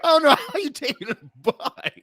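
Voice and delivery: high, laughing through the words; can't hold the laugh while teasing; laughing tease. Real, not acted.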